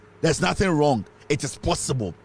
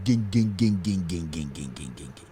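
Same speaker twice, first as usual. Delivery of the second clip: "ging, ging" sinking until it dies out; machines winding down; 10 semitones lower, 5 dB quieter than usual. Retold or imitated. Tells listeners how every excavator would go silent, a fading imitated clank. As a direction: imitate machines clanking to a halt, dropping lower and fading away. Machines shut down across Ghana.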